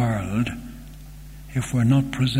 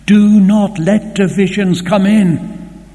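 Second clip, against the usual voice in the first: loud, high pulpit cry; urgent preaching shout; forceful warning; 8 semitones higher, 10 dB louder than usual. Real, not acted. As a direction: thunder the warning at the congregation. Preacher's emphatic warning to church.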